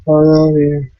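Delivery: flat, deadpan delivery; monotone voice. Retold or imitated. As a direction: say it with zero feeling. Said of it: "in this monotone voice".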